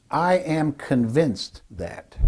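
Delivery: very slow, measured power phrase; definitive. Shown as a demonstration. Speaker coach models a power phrase, very slow and definitive. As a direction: spell out your conviction word by word. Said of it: "hear what they sound like".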